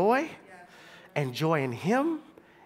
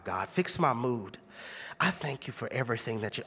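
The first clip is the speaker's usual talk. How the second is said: quiet prayer; 7 semitones lower, 5 dB quieter than usual. Retold or imitated. A college student praying softly before class, low and hushed. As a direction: pray under your breath to calm down. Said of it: "hold on real second".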